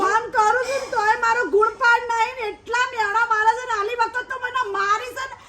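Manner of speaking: high-pitched crying grievance; tearful wailing complaint. Acted. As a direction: wail to mother about being mistreated. Daughter wails about mother-in-law's abuse.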